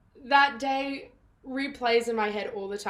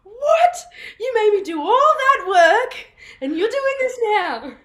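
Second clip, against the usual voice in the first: high, loud indignant squawk, laughter underneath; mock outraged mum; 10 semitones higher, 9 dB louder than usual. Actress imitating mum learning the accent work was needless, outraged. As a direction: imitate an incredulous mother's outburst. Acts out mum's outraged reply.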